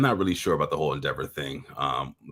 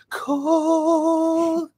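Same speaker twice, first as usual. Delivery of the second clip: drawn-out high announcer call; much slower than usual. Acted. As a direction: stretch it like a ring announcer. Announcing sticker name dramatically.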